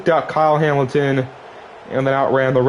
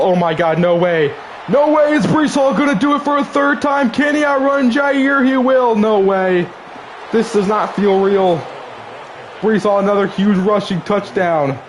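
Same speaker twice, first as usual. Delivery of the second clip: shrieking jackpot winner; 8 semitones higher than usual. Real, not acted. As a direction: scream like you can't believe it. Unbelievable third touchdown.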